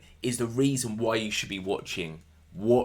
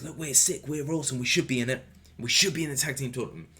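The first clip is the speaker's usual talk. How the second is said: higher-pitched imitation of keen teammates; mock eager huddle; 4 semitones higher, faster than usual. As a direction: pitch it up, pleading the team's case. Voicing wrestlers' eager huddle.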